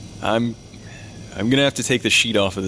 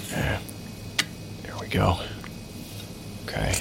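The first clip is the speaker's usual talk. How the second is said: whispered; 2 semitones lower, 9 dB quieter than usual. Acted. Examining the body.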